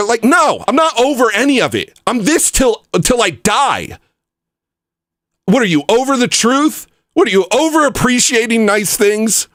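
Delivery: high, indignant rant; fed up. Real, not acted. Streamer challenging people who are over everything, fed up. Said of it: "I'm so sick of it".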